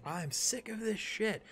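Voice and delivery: high, slow, exasperated growl of a line; weary fighter mid-swing, fed up; fed-up warrior. Pretend. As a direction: spit it out like you're done with the fight. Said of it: "you're like".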